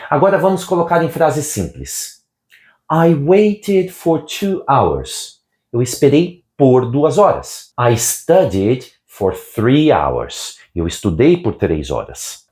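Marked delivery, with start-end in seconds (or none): 2.9-5.4 slow, clear English example; slow model sentence. Shown as a demonstration. Teacher reading an example slowly to Brazilian learners. 7.8-10.6 slow, clear English example; slow model sentence. Shown as a demonstration. Teacher reading an example slowly to Brazilian learners.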